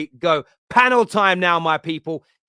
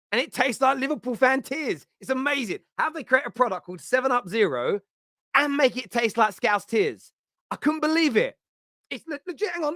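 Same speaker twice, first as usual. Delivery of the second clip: high, gloating mock delight; hammed-up joy at a rival's misery; gleeful mocking; 8 semitones higher than usual. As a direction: relish the taste of rival tears, pitched way up. Mock tasting Liverpool fan tears.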